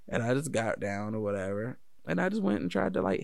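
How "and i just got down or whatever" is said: usual speaking voice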